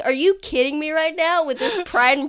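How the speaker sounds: excited disbelief. Retold or imitated. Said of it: "I was like".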